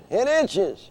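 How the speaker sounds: high puppet whine. Acted. Dummy Orson explaining to his handler, high whiny puppet.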